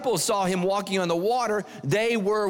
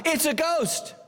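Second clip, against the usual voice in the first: high mock cry of fright, pitched way up; a hint of panicked disciples crying out; frightened cry; 10 semitones higher, slower than usual. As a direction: cry out like terrified sailors. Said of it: "they cried out".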